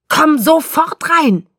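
high, loud, slow command; angry shout from the house. A narrator's reading. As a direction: bellow it like a furious father. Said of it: "brüllte Charlie vom Haus her".

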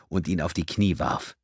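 plain reading voice

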